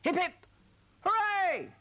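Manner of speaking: high, loud hip hip hooray; practice cheer. Shown as a demonstration. Presenter rehearsing the cheer with young viewers, loud call. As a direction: lead the children in a big cheer. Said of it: "let's have a practice".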